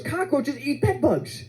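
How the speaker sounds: high, slow exterminator's explanation; insistent exterminator. Retold or imitated. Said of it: "He goes".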